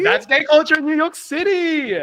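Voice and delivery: high, loud, emphatic confirmation; excited declaration. Real, not acted. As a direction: proclaim it with glee.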